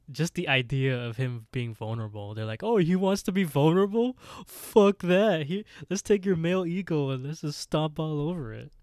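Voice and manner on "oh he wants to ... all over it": loud, high-pitched jeering; boisterous mock bullying, pitched way up; mocking taunt. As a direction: pile on with hammed-up scorn. Voicing kids piling on vulnerable boy.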